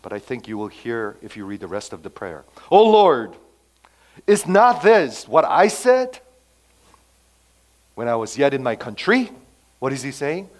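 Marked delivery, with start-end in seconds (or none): 2.7-9.3 indignant complaint. Retold or imitated. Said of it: "what kind of tone you hear".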